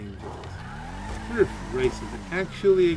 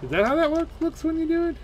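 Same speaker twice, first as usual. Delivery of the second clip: surprised; 6 dB louder than usual. Real, not acted. Surprised at game mechanic.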